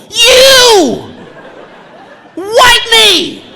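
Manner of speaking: high, loud mock toddler command; bossy kid yell. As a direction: bark it like a tiny boss. Son pointing and yelling.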